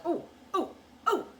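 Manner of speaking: short high oh, repeated; imitated tic noises. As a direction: pop out separate, sudden hoots. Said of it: "like, all ticking and stuff".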